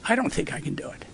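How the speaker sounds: soft, defeated self-doubt; almost to himself, giving up; despairing. Retold or imitated. Driving home crying, doubting himself, soft and defeated.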